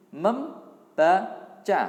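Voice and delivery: very slow, loud, syllable-stretched; sounding out words. Shown as a demonstration. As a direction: stretch each syllable loudly for the class. (mengeja pelan per suku kata)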